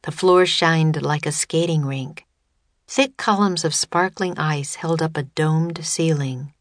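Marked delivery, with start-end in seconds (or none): none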